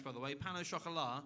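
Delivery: ordinary talk, nothing marked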